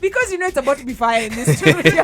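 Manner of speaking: laughing loudly while talking. Real, not acted. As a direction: laugh through the hype. Laughing hype about studio fight.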